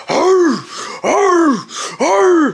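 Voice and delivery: high, punchy effortful grunts; imitated grunts. Wrestler carrying the narrator round the ring, imitated grunting. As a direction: grunt like a wrestler lugging a load.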